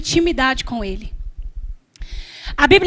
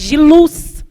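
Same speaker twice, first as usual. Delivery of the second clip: ordinary talk, nothing marked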